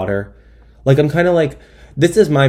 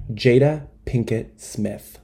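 ordinary talk

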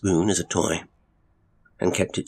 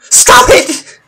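furious high-pitched scream; very loud, enraged shriek; 16 dB louder than usual. A narrator's reading. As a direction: scream the order at full force. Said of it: "she screamed".

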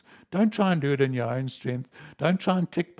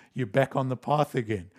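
normal talking voice

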